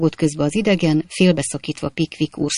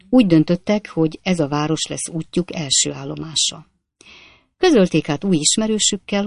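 ordinary delivery

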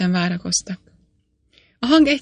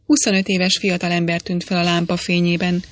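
plain reading voice

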